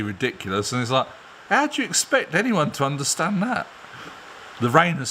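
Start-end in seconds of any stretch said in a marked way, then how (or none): 1.5-3.7 high, mock-baffled question; mock incredulous. Mocking English people's fake French accents, incredulous. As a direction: ask it in high-pitched disbelief.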